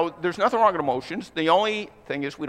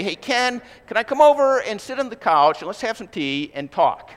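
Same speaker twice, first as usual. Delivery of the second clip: higher, chummy mock buddy voice; dude on the phone; 6 semitones higher, 4 dB louder than usual. Pastor imitating a buddy asking to come over, a light mock voice. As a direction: lightly voice a pal inviting himself over. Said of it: "had a dude call me up and say".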